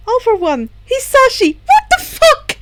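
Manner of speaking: yelling at the father at the door; outraged yell. Acted. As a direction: shriek it in outrage.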